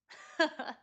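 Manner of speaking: high cackling laugh; witch cackle. Acted. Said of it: "Eve's, uh, witch voice now".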